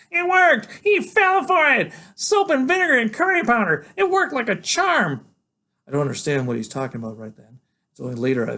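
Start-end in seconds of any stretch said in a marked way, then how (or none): 0.1-5.3 very high, loud triumphant squeal; manic glee. Acted. Freak crowing over his trick, very high and loud. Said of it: "laughing like a maniac and saying".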